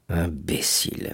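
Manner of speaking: muttered insult, low and slow; soft, low, drawn-out murmur under the breath. A narrator's reading. Danglars to himself about Fernand, murmured. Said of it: "murmura Danglars".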